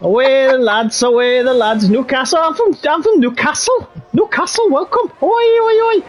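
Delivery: hammed-up Geordie calling out; mock Geordie accent. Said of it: "waiting for the Geordie to come out next".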